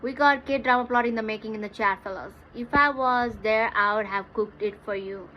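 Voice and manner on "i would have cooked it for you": ordinary talk, nothing marked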